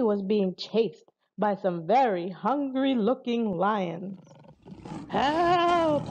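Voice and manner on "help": high, drawn-out call for help; crying for help. A narrator's reading. Hunter chased by lions calls the flyers, high cry. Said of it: "he cried".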